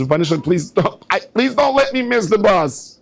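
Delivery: put-on a young mother begging God not to miss the bus, high pleading; desperate prayer. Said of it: "She says".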